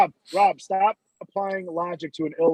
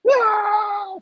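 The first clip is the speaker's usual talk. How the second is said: very high drawn-out scream; long mock scream. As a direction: hold a long panicked scream. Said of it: "the dude that plays Stewart is like".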